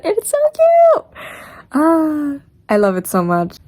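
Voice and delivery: very high, excited squeal; squealing gush. Real, not acted. The editor gushes over a callback to the first film, a very high squeal. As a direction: gush like a fan who can't contain it.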